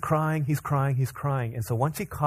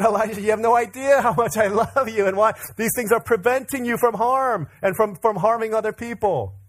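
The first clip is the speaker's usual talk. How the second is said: high, raised, pleading dad voice; hammed-up, pitched-up earnest protest; impassioned parental plea; 7 semitones higher, 3 dB louder than usual. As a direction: plead your case to a small child. Dad's retold impassioned reply to son.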